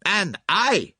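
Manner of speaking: anger bursting out, high and loud; Poirot. A narrator's reading. Poirot turns on himself, rising angry cry. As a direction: burst out against yourself. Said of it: "his anger burst forth freely".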